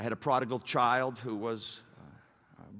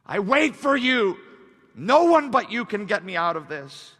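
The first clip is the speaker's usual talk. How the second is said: impassioned declaration; 9 semitones higher, 11 dB louder than usual. Acted. Preacher as David waiting on God, loud declaration. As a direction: proclaim it straight to God.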